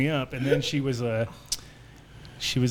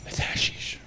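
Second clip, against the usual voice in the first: hushed principal; 3 dB quieter, slower than usual. Retold or imitated. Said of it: "And he goes".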